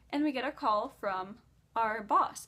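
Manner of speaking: usual speaking voice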